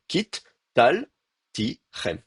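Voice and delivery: "kit, tal, ti, rem" split by clear pauses; syllable division. Shown as a demonstration. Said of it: "au niveau du découpage en syllabes".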